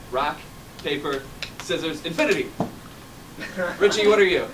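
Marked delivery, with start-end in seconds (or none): 0.1-2.5 "Rock, paper, scissors, infinity" spaced out as a countdown; counting in. Real, not acted. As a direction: pause after each word, then launch INFINITY. Counting players into their pose.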